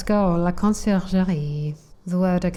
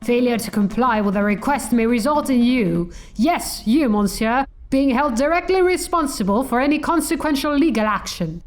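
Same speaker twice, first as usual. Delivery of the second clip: higher, emphatic legal bluster; officious lawyer Falcon; 4 semitones higher than usual. Acted. Lawyer bluffing a guard with criminal codes, officious. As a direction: build up forceful legalese to intimidate. Voices Falcon reciting law.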